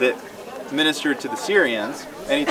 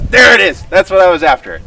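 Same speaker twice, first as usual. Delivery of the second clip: loud, much higher, delighted there it is; triumphant exclamation; 6 semitones higher, 12 dB louder than usual. Retold or imitated. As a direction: burst out like you finally got the answer. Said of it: "And then Jesus goes".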